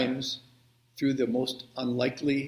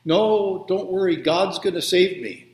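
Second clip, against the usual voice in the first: raised, louder storytelling yell; acted yell; 5 semitones higher, 4 dB louder than usual. Stranded man refuses the helicopter's help, yelling. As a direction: call it up higher, waving the rescuers off. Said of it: "And he yells".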